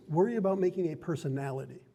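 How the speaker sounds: normal talking voice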